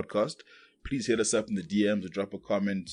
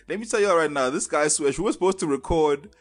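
loud; 6 semitones higher, 4 dB louder than usual. Real, not acted. Calling out cohost to listeners.

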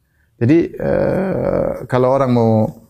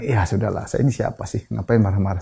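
softer, resigned voice of a humble man; humble shrug; 5 semitones lower, 6 dB quieter than usual. Retold or imitated. Preacher voices a humble man letting go, softly resigned. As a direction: let it go with a soft sigh of surrender.

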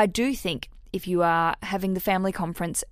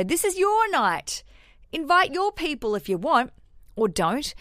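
high sing-song quote; 7 semitones higher than usual. Retold or imitated. Parents handed the rehearsal dinner.

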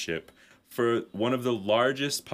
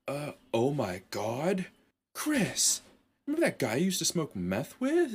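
hammed-up amazement; mock dawning realization; 5 semitones higher than usual. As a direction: let the memory hit you, pitched up. Hosts suddenly remember Sean.

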